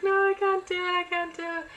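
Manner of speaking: high, loud, desperate mock panic; panicked refusal. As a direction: cry it out like you're falling apart. Retold panic before exam.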